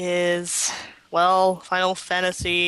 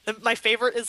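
ordinary talk

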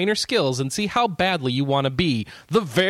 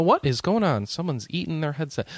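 put-on old-timey voice; 9 semitones higher than usual. Shown as a demonstration. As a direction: read it like an old-time radio host. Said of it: "I'm gonna read 'em in an old-timey voice".

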